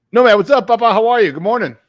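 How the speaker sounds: loud, lifted shout-out; hyped greeting. Real, not acted. Host hailing his guest on air, hyped greeting. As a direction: call out a big hello.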